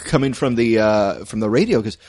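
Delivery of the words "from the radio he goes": ordinary delivery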